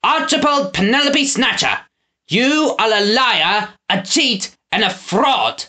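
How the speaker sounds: high, slow, furious accusation; shouting. A narrator's reading. Lord Portly Ryan denouncing Snatcher, furious shout. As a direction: denounce him name by name. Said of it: "Lord Portly Ryan shouted".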